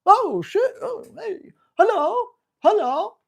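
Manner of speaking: squeaky happy puppy greeting a stranger; carefree puppy voice. Retold or imitated. Dog trainer voicing his bold puppy, high and excited. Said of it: "And my puppy goes".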